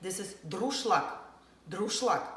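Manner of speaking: druszlak said DROOSH-lak, stress on the first syllable; Russian druszlak. Shown as a demonstration. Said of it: "I'm gonna tell you now".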